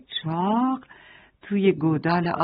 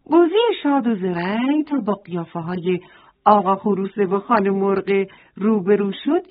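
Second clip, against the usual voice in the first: ordinary delivery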